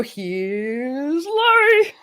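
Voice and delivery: slow, drawn-out show host intro; announcer intro. Shown as a demonstration. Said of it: "you're supposed to go".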